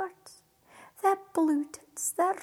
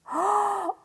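gasp; 5 semitones higher than usual. Acted. Betty hears of three wishes.

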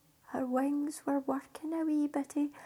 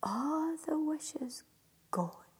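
hushed storytelling; 7 semitones lower than usual. A narrator's reading. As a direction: let the ending fade to a whisper. Hushed close of the tale.

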